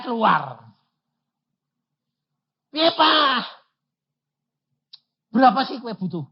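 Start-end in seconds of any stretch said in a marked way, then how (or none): 2.7-6.3 put-on high, eager child's voice; child asking. Pastor voicing his child asking how much is needed. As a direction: ask like an excited kid.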